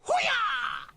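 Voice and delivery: shrill high whoop thrown out for fun; high mock whoop. Whooping in jest.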